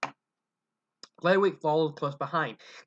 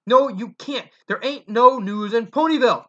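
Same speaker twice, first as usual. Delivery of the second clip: loud retort; 6 semitones higher, 8 dB louder than usual. Acted. Mother cuts him off.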